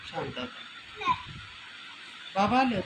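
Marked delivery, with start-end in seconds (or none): 0.1-1.1 very slow, high, soft coaxing; soothing hush. Real, not acted. Parent lulling a sulking child to sleep, slow and soft. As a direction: hush her gently into sleep, drawing it out.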